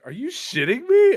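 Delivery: incredulous outburst. Retold or imitated. To the car warranty caller, high incredulous outburst. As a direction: shoot it up high in disbelief. Retold reaction to scam caller.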